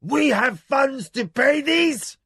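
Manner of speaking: loud, high, slow yell into sudden silence; shouting. Acted. Husband shouts as the music stops. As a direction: bellow it out. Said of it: "shouting into stillness".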